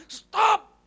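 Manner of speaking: high, loud, abrupt cry; commanding shout. Real, not acted. Preacher calling to stop looking back, commanding shout. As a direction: halt them with one word.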